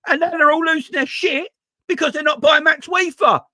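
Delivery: loud, high exasperated outburst; exasperated rant. Real, not acted. Vlogger rants at fickle Liverpool fans, loud and exasperated. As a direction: let the frustration burst out.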